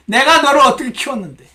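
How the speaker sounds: high, loud reproachful scolding; nagging. Acted. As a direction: pour out an aggrieved nag. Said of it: "고모가 영락없이 잔소리를 퍼붓기".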